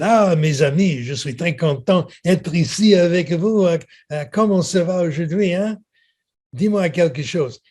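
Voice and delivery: a schoolboy hamming up a French accent to mock the examiners; exaggerated French accent. Teenage boy mocking French examiners in a hammed-up French accent. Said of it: "an exaggeration of his French accent".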